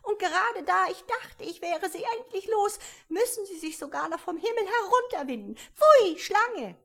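indignant pigeon, building to a shriek. A narrator's reading. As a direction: fling out the Pfui, loud and high. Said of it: "fuhr die Taube mit erhobener Stimme fort".

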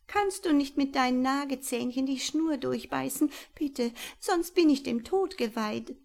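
trapped owl pleading for rescue; desperate owl. Acted. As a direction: beg for your life. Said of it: "bat sie verzweifelt".

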